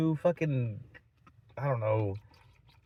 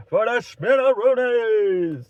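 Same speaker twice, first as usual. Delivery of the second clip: loud, high, stretched-out "spinneroonies"; silly exclamation; 7 semitones higher, 9 dB louder, slower than usual. Pretend. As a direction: belt out the goofy word slowly. Repeats silly punchline as exclamation.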